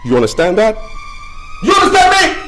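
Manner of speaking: loud and high, demanding; angry, possessive shout. Acted. Husband shouting at wife that he wants her back, angry. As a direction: hammer every line at her.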